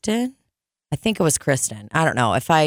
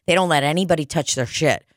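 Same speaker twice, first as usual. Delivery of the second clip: ordinary talk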